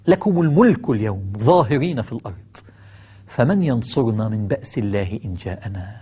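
solemn recitation. Acted. Recites verse, fading soft.

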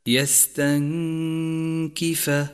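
"يستنكف" chanted, n hidden in a long nasal hum before k. Shown as a demonstration. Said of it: "حكم الإخفاء في كلمة". («يستنكف» بإخفاء النون مع الغنة)

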